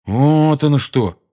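drawn-out "so that's it"; dawning understanding. A narrator's reading. Ron catching on to the quarrel, dawning understanding. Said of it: "на его веснущатом лице проступило понимание".

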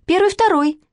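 very high, piping count-off; little girl calling out. A narrator's reading. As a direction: squeak out the drill response. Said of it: "крикнула Манечка". (выкрик совсем тоненьким детским голоском)

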